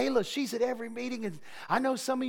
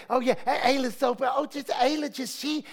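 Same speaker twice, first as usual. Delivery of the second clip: high, loud sing-song mockery of the name; mocking gossip; 5 semitones higher, 3 dB louder than usual. Pastor mimicking gossiping churchgoers, high mocking sing-song. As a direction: taunt the name like jealous gossips. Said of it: "they get together and say".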